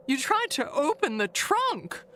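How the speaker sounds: normal talking voice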